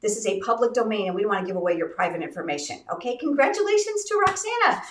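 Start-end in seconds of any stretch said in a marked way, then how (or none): 3.2-4.8 high-pitched, celebratory lift; excited congratulations. Real, not acted. Host congratulating the first winner, excited and high.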